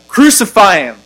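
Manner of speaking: loud, high mob cry; crowd crying out. Acted. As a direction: cry it out like a crowd demanding death. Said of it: "they cried out".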